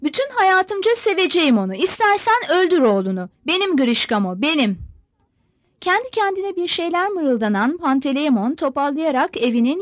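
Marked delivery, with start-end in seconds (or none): none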